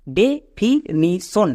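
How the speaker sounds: Definition very slowly, broken as ডে-ফি-নি-শন; syllable by syllable. Shown as a demonstration. Teacher showing students how the split word is pronounced, syllable by syllable. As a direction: sound out definition in four separate, drawn-out parts. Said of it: "এবার একসঙ্গে উচ্চারণ করো".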